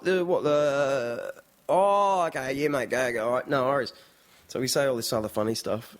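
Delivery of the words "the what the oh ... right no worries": bogan Aussie, stretched words then tumbling on. Retold or imitated. Local giving a newcomer directions, lightly imitated bogan Aussie. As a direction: imitate a bogan fumbling for words, drawn-out start. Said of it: "confused by our bogan accent".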